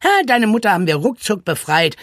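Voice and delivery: boastful, lively call; Charlie Gottchen. A narrator's reading. The voice promises Franka a quick rescue, calling out. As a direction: call it out with breezy confidence. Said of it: "rief die Stimme Charlie Gottchen".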